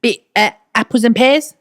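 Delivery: put-on Cockney accent. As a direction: do a hammed-up Cockney. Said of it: "I can also do this kind of London".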